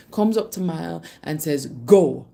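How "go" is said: loud, low, abrupt order; punchy mock shout, pitched low; retold shout. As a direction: imitate a bossy boy shouting a command. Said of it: "he shouts really, really loud".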